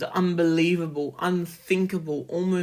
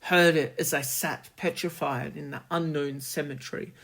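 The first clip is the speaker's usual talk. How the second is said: ordinary delivery, nothing marked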